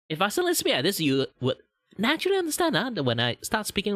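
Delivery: high-pitched Malaysian accent. Shown as a demonstration. Showing listeners how their Malaysian accent sounds, high Malaysian accent. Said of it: "speak in a very Malaysian accent".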